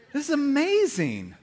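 high-pitched mock delight; delighted amazement. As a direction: marvel at it in a high voice. Acts delight at puppy offer.

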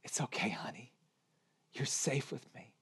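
imitated soft, hushed reassurance; father's tender, quiet comforting; gentle whisper. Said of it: "I whispered gently".

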